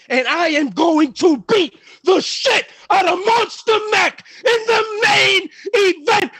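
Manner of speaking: loud, high wrestling promo yell; promo shout. Real, not acted. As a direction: roar it at the man across the ring. Wrestling promo, calling out opponent.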